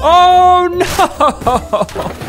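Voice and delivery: high, loud drawn-out "oh no"; dismayed cry. Real, not acted. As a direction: cry out as the jump goes wrong. Jump fails in game.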